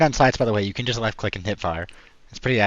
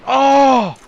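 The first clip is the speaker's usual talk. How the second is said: sudden high yelp of surprise; startled cry, much higher and louder; surprised shout; 13 dB louder than usual. Real, not acted.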